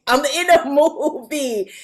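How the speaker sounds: ordinary talk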